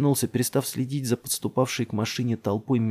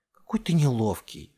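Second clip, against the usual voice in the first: mild reproach, slightly raised voice; reproachful; 3 semitones higher than usual. A narrator's reading. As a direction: chide a clumsy playmate. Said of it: "упрекнула его Делия".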